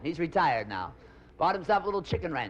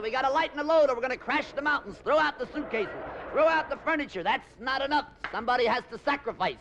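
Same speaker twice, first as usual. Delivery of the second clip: higher, urgent co-pilot; co-pilot orders; 6 semitones higher than usual. Acted. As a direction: bark out emergency orders.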